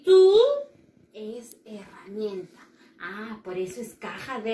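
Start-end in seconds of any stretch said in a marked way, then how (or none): 1.1-2.6 whisper. Pretend. Whispered hint to class.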